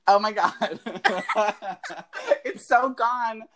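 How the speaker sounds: high laughing embarrassment. Real, not acted. Laughing at her own mistake.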